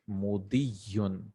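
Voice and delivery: slow, low "mudiyun" with an i before the doubled y; careful teaching demonstration of "mudiyun", i in place of u; kasra vowel. Shown as a demonstration. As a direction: sound out "mudiyun" slowly, drawing out the "diy". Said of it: "previous letter to have a kasra".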